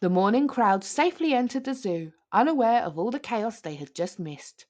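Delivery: usual speaking voice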